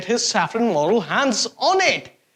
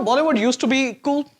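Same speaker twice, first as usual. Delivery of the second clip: ordinary delivery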